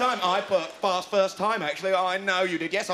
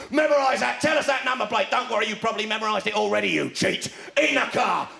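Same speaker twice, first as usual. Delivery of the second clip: mock examiner bark; slower than usual. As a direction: bark commands like a drill instructor. Examiner barking orders.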